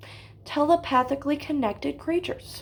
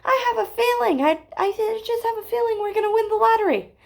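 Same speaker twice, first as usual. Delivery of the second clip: higher-pitched, hurried imitation of a mom; lightly voiced mother; 6 semitones higher, faster than usual. Mom on the phone to her child, lightly voiced mother. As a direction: lightly play mom having a hunch. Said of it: "and then she goes".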